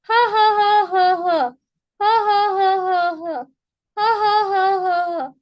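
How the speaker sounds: loud, high rhythmic ha-ha; repeated staged bursts, each falling in pitch; hearty demo laugh. Shown as a demonstration. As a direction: laugh your heart out on purpose. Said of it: "laugh your heart out".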